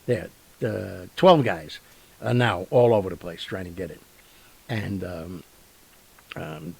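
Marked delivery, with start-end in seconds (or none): none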